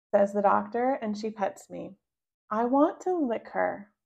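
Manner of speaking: ordinary delivery, nothing marked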